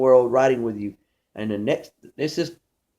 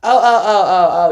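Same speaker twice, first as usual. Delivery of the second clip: high, loud eager oh oh oh; confused friend thinks he's got it, excited; excited realization; 5 semitones higher, 9 dB louder than usual. Retold or imitated. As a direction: burst in like you finally get it.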